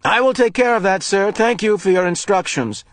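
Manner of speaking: high, bright service voice; a polite voice over the wall speaker. Acted. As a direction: official answering the intercom. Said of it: "the voice said".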